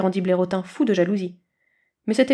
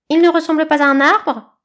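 high, loud know-it-all eagle voice; Aiglon remarking; 7 semitones higher, 7 dB louder than usual. A narrator's reading. Eagle feels obliged to comment, high and loud. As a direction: point it out from above. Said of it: "se crut obligé de faire remarquer Aiglon".

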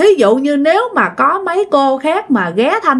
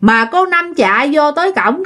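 usual speaking voice

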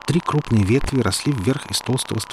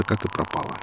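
ordinary delivery

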